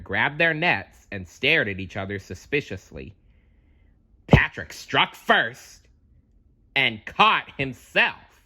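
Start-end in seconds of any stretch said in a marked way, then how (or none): none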